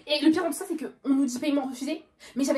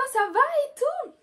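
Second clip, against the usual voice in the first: high, slow, sugary greeting; sweet lady voice; 13 semitones higher, 4 dB louder than usual. Retold or imitated. Kind shop lady greeting a regular, high sweet voice. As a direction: sing it like a friendly shop lady.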